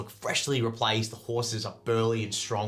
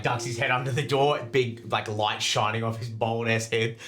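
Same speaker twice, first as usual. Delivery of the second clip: normal talking voice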